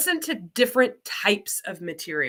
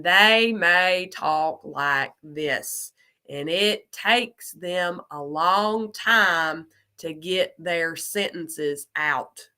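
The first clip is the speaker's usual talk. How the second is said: very slow, stretched Southern vowels; Southern US drawl; 3 semitones lower, much slower than usual. Shown as a demonstration. Teacher showing how her Southern family talks, slow Southern drawl. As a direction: draw it out like deep Southern Georgia. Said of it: "my family is very Southern".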